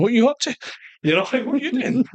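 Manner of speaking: high, playful mock accusation; mock suspicion. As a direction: toss it back at them, teasing and high. Eyeing other detainees, joking.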